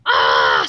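loud, drawn-out "aah" of exasperation; frustrated scream. A narrator's reading. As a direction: let out a loud frustrated roar. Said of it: "screamed Mister Krupp in frustration".

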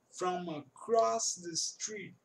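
slow, "from across" run together as one; linking from and across. Shown as a demonstration. Teacher models linked speech slowly for students. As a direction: glide the end of "from" into "across", slowly. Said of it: "ele junta o from".

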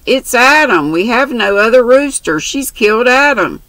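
distressed cry. Acted. Faith discovers pet killed.

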